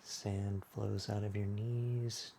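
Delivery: unhurried, hushed yoga-class body scan; guided meditation. Acted. Leads a guided relaxation.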